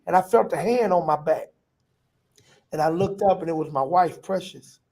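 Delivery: ordinary talk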